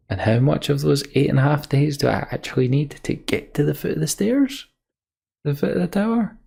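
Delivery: can't believe how far it is; incredulous. Real, not acted. Gamer asking how far the tower really is, incredulous. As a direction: voice climbing in disbelief. Streamer baffled by the game's timing.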